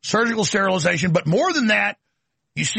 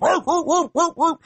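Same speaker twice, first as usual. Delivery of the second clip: high, laughing woof woof of a police dog; mock dog barking; 10 semitones higher than usual. Host mocks the police dogs at the courthouse, laughing bark. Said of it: "literally police dog".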